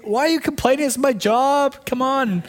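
high-pitched defensive retort; exasperated clerk. Acted. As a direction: act out a fed-up clerk answering back. Acting out clerk snapping back.

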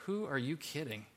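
a small voice undercutting him; doubting inner voice. Pretend. Moses's inner voice doubting the mirror pep talk, soft scoff. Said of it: "the little voice inside him goes".

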